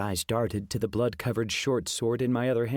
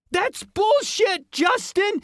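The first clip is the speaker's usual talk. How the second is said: put-on very high, slow, shrieking kid; a boy yelling in terror; squeaky shout; 6 dB louder, slower than usual. A narrator's reading. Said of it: "Ian shouted".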